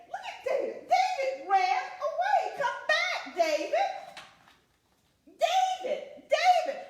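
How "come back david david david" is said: put-on high, loud call after a runaway child. Mother calling after David as he runs away, high loud call. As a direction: shout after him to come back.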